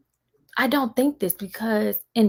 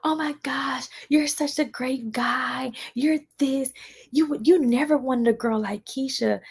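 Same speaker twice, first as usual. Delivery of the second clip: high, airy, slow gush; fake-sweet mock praise; 6 semitones higher, slower than usual. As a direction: coo it like a fake admirer. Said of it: "when he got married".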